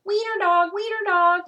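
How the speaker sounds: high, loud mocking chant; high loud teasing chant; laughing taunt. A narrator's reading. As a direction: chant it loudly at him. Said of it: "laughed the other dogs".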